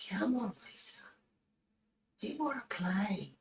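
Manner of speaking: high, breathy spooky whisper; eerie whisper. Pretend. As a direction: lure Lisa in with a creepy whisper.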